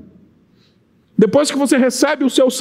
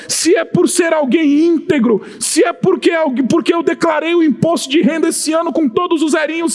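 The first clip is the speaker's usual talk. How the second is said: preaching at full force; 6 semitones higher, slower than usual. Acted. Pastor railing at works religion before the church, full force.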